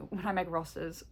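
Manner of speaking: ordinary delivery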